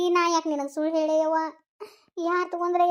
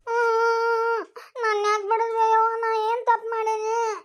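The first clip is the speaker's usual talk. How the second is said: whimpering cry; 6 semitones higher, slower than usual. Acted. Scolded daughter whimpers.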